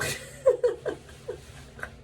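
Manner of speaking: high, breathy burst of laughter. Real, not acted. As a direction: crack up helplessly at your own story. Laughs at her own story.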